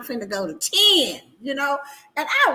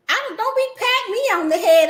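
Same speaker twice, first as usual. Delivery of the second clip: indignant; 9 semitones higher, 4 dB louder, faster than usual. Real, not acted. Annoyed at head patting.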